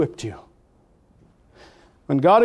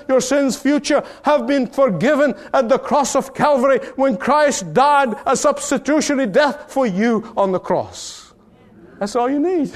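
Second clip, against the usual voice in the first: higher, building preacher's call; full-force preaching; 7 semitones higher than usual. Acted. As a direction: drive it home from the pulpit.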